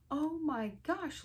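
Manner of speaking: high, drawn-out alarmed screech. A narrator's reading. Reader voicing a girl who spots fire, screeching. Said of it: "Liza screeched".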